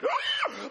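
high, loud panicked shriek; hammed-up scream of sudden discovery; terrified scream. Rider finally looks down at the fire, screaming.

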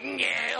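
loud, strangled "nyeh" honk; feeble car horn imitation. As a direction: bleat like someone getting choked. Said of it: "getting choked in the backseat".

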